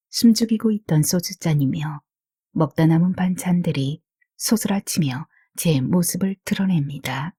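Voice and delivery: ordinary delivery, nothing marked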